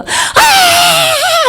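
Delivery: long, very high-pitched wailing cry; imitated wail. Imitating a woman wailing beside the healed man, wailing. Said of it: "어떤 여자가 통곡을".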